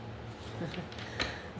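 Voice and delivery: quiet, voiceless little laugh; soft chuckle. Real, not acted. Tarot reader amused by the cards, soft chuckle. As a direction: laugh softly to yourself.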